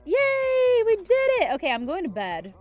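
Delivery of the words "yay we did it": high, loud cheer; much higher, louder, drawn-out yay. Real, not acted. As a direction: celebrate in a big squeal. Celebrating in the game.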